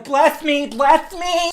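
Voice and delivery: mock a desperate fan begging for a blessing. Fans reaching for Superman, mock pleading. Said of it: "they just wanna touch 'em".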